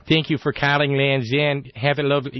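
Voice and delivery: imitated upper Midwestern phone agent; upper Midwestern accent. Land's End agent thanking a caller for ordering, imitated upper Midwestern accent. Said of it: "that upper Midwestern thing".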